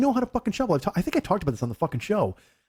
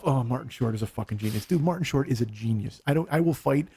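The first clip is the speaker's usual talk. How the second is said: usual speaking voice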